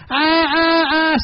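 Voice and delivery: very high mock bray; donkey braying. Donkey still braying as the shovelling goes on. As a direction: bray desperately from the well. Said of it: "said the donkey".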